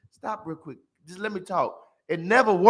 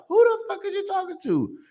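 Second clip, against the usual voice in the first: indignant woman; 13 semitones higher than usual. Retold or imitated. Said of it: "she'd be like".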